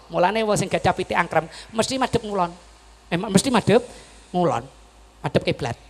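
ordinary talk, nothing marked

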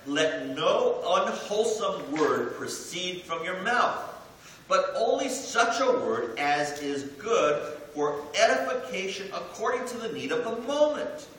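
slow, emphatic Bible reading; scripture reading. Acted. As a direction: read it out like a preacher. Reads scripture aloud.

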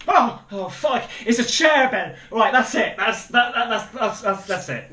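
high, loud stammering outburst; yelp, then stammer that it's over; real rattled fright, easing off at the end; startled panic. Real, not acted. Startled by a chair in game.

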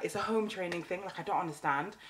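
usual speaking voice

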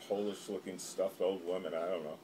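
ordinary delivery

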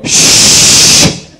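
unvoiced shush; imitating the shush noise. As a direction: hush them with a sharp shh. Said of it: "They go".

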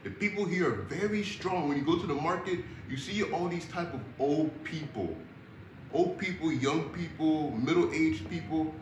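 ordinary talk, nothing marked

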